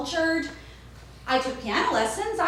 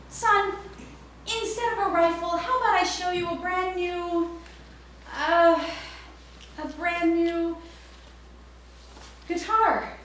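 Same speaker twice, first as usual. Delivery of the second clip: higher, sweet coaxing pitch; coaxing saleswoman; 7 semitones higher than usual. Retold or imitated. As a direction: sell it to the kid gently.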